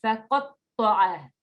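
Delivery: Faqatta'a drawn out slowly, heavy thick t; slow modelled word. Shown as a demonstration. Teacher modelling for student, slow careful Faqatta'a. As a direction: spell out Faqatta'a syllable by syllable. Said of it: "repeat after me".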